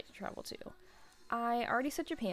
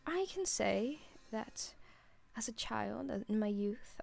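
attempting a British accent, failing; 2 semitones higher than usual. Shown as a demonstration. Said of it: "I can't do it anymore".

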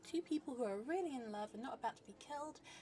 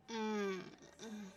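ordinary delivery

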